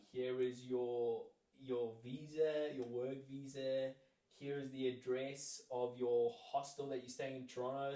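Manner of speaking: slow official read. Retold or imitated. Reading out the folder contents.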